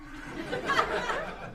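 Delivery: high, soft giggle; chuckle. Real, not acted. Comic amused at a president who can't lie, soft high giggle. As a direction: giggle softly at your own joke.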